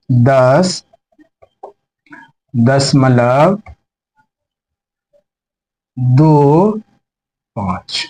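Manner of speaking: each digit said alone with long pauses; slow digit reading. Shown as a demonstration. Teacher reading the decimal slowly for students, long pauses. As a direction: read "दस दशमलव दो पाँच" digit by digit, very slowly. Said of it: "तो इसको पढ़ेंगे".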